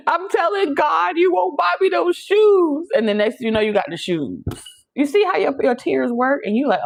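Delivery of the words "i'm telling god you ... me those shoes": put-on whining child. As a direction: wail like a kid denied shoes. Child crying for shoes.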